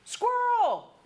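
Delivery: sudden high, loud shout; Doug. Retold or imitated. Talking dog breaks off mid-sentence at a rustle, shouting. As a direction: yelp it out like a suddenly distracted dog. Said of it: "he jerks his head and he shouts".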